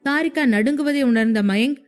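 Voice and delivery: usual speaking voice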